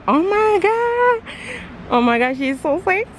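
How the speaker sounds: high-pitched, swooning excitement; excited squeal. Real, not acted. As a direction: squeal over your dream car. Spots her dream car.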